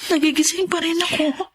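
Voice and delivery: high, hushed and near tears; voice breaking. Acted. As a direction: let the voice crack on the words. Grieving mother confides to mother-in-law.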